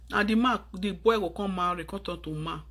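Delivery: ordinary delivery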